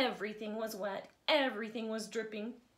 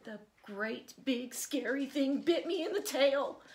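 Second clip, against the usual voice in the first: a bit louder, higher fib; mock yell; 5 semitones higher, 7 dB louder than usual. A narrator's reading. Johnny Lion telling Mama a fib, a mild mock yell. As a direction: cry it out playfully. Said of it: "yelled Johnny Lion".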